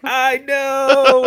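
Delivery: proud little dog voice; smug dog. Retold or imitated. Host voicing the talking dog agreeing, high and smug. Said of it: "the dog is like".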